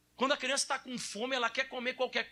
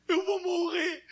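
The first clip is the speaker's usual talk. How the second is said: teasing, pitch up and louder, playing dead; hammed-up wail pitched up; mock dying wail; 8 semitones higher, 5 dB louder, much slower than usual. Father teasing his son with a mock dying wail. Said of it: "brinquei com ele".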